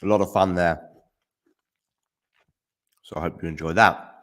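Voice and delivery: talking with a mouth full of chocolate; chewing while talking, mouth full. Real, not acted. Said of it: "I'm just hunched on a bit of chocolate".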